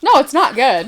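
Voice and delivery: laughing. Real, not acted. Admitting a bad accent to cohost, laughing. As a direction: say it through a laugh at yourself.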